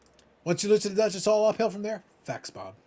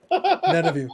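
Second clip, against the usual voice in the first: high, louder dramatic "None of you"; mock defiance; 12 semitones higher, 5 dB louder, slower than usual. Telling the chat nobody can hurt him now, mock-dramatic. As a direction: declare it like a wounded hero.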